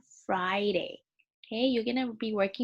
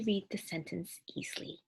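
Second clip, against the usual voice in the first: whisper; 3 semitones lower, 9 dB quieter than usual. Acted. Teacher whispers an aside.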